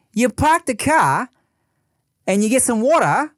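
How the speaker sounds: Boston vowels with a British lilt, Australian; Australian accent. Shown as a demonstration. Imitating Australian for his friend. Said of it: "throw a little British on there".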